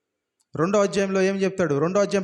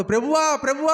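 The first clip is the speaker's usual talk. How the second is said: higher, louder panicked cry; desperate shout; 7 semitones higher, 3 dB louder than usual. Retold or imitated. Latecomers shouting after the departing Lord, frantic cry. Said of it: "అని అరుస్తారు".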